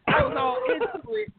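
high, loud excited cry. Real, not acted. Excited retelling of the hype.

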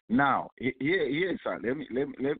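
ordinary talk, nothing marked

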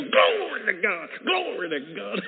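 high, drawn-out shout of praise; imitating a long, ringing cry of worship; angel's praise cry. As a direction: stretch out the praise, loud and high. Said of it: "He go".

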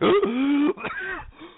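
high-pitched strangled wail; imitated choking noise. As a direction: imitate someone being swallowed up. Imitating Neo overtaken by liquid.

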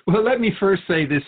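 amused well with a laugh, high and loud. Real, not acted. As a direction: laugh it out before answering. Laughing sarcastic reply.

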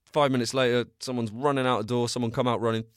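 ordinary delivery

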